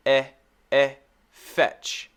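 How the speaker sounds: Fe, eh, then fetch, built up slowly; fetch broken into sounds. Shown as a demonstration. Teacher breaking the word into sounds. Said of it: "repeat breakdown".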